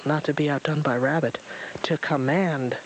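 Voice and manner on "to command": usual speaking voice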